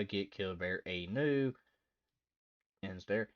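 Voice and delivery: normal talking voice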